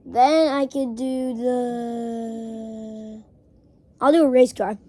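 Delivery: ordinary talk, nothing marked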